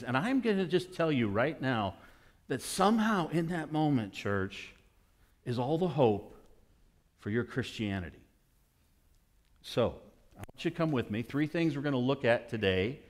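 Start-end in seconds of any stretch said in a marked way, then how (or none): none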